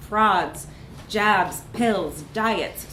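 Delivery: ordinary delivery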